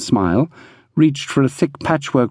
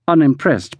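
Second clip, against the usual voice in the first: usual speaking voice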